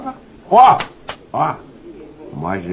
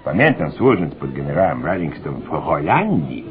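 ordinary delivery

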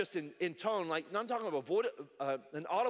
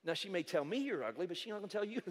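normal talking voice

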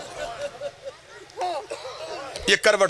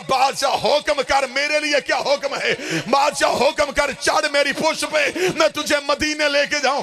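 high, urgent lamenting plea; tearful pleading; 10 semitones higher than usual. Acted. Horse begging its master for a command, tearful pleading. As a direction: beg at high pitch. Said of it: "रो के लगा".

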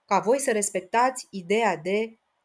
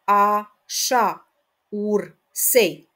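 syllable counting; 6 dB louder, much slower than usual. Shown as a demonstration. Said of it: "haideți să despărțim împreună".

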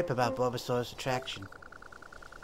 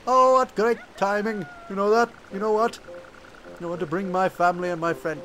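lightly voiced accent as Bidoof, higher; a shaky attempt at a foreign accent; put-on accent; 7 semitones higher, 3 dB louder than usual. Gamer voicing Bidoof in an unsure put-on accent. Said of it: "I don't even know if that's a good accent".

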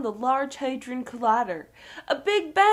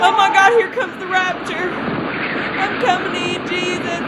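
terrified; 2 semitones higher, 5 dB louder than usual. Acted. Reporter faces the end.

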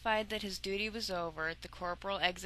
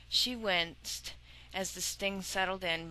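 ordinary delivery

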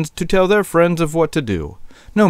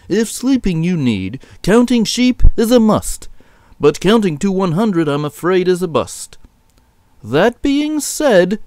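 higher-pitched shepherd voice, measured delivery; instructing a sick caller, pitched up; shepherd boss advising; 6 semitones higher than usual. Acted. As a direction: lay out the plan in a raised shepherd voice.

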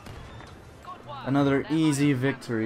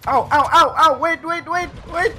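panicked yelps; 6 dB louder than usual. Real, not acted. Hurt in game.

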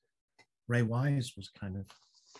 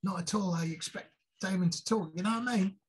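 much higher-pitched put-on voice; Harvey Stevens impression; 8 semitones higher, faster than usual. Showing friends how the actor talks, high-pitched imitation. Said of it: "talk like that".

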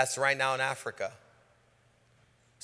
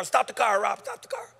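urgent call; 8 semitones higher than usual. Retold or imitated. Urgent call to stop car.

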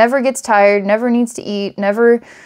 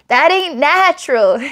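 a lightly put-on hillbilly accent, higher; 7 semitones higher than usual. Hillbilly telling the listener, lightly voiced hillbilly accent. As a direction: play a country hillbilly, half in jest. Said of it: "this hillbilly telling you".

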